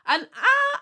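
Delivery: a high, loud gasp of glee; can't hold in the excitement; excited squeal. Real, not acted. Gushing about the brand.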